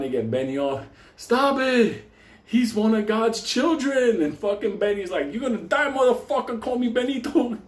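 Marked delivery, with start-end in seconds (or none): 1.2-4.2 priest's urgent, high-pitched protest; acted pleading shout. Priest pulling attacker off. 5.3-7.6 high-pitched enraged threat; acted angry threat. Benny threatens during the fight.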